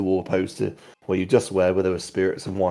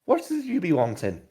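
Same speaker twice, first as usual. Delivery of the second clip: high, chiding barmaid voice; tavern owner voice; 9 semitones higher, 4 dB quieter than usual. Acted. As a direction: scold him like a prim landlady. Lara voice.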